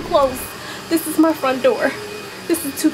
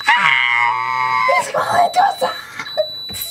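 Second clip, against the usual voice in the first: panicked scream; 12 semitones lower, slower than usual. Real, not acted. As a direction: scream as it comes closer. Screams as spider crawls.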